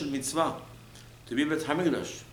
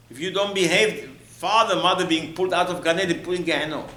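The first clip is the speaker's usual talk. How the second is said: louder, higher warning; sermon warning, voice raised; 5 semitones higher, 4 dB louder than usual. Real, not acted. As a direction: preach it out with alarm. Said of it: "preacher warning".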